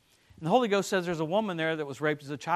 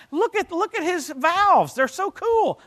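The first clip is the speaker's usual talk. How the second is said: mock gushing; 11 semitones higher, 3 dB louder, slower than usual. Mock gushing over unseen organs.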